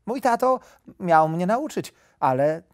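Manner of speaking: high, earnest small-animal voice; little hare. A narrator's reading. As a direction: answer as a naive little hare. Little hare answers the wolf.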